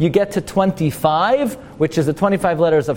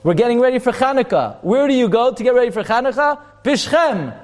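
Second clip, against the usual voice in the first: father's eager instruction; 8 semitones higher than usual. Retold or imitated. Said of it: "Yaakov tells Yosef".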